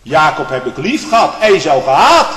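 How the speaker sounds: fiery preacher climbing to a high, loud peak; preaching at full force. Acted. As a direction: build the sermon into a roar.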